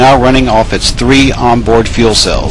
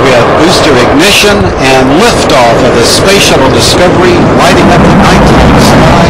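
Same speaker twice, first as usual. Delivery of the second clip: rising, louder liftoff announcement; excited launch call; 5 semitones higher, 4 dB louder than usual. Real, not acted.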